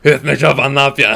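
loud laughing cop voice; role play. Pretend. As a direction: play a cop joking on the radio. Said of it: "ufak bir R P yapayım dedim".

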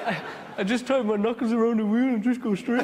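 put-on high, loud Brummie accent; Nigel Mansell. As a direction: impersonate a driver with a Birmingham twang. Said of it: "brought me close to that Brummy accent".

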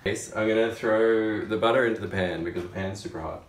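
normal talking voice

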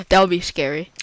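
ordinary talk, nothing marked

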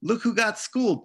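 high, loud mocking sneer; taunting bully. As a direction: jeer at her like a schoolyard bully. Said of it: "taunted Natalie Gooch".

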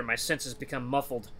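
usual speaking voice

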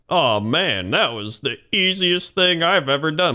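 mock brag, pitch climbing into the punchline; boastful. Giving an example of bragging, boastful. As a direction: show off like an insufferable braggart. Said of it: "saying something in a boastful manner".

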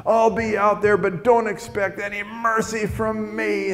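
high, strained mock scolding; angry dad. Dad on the phone to his drunk son, mock-furious. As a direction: act out a furious father, drawing the words out. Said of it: "his dad is just angry".

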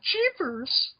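very high put-on child voice; fifties sitcom kid. Friends spoofing a fifties show, hammed-up sitcom kid. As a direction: squeak it like an old-TV kid.

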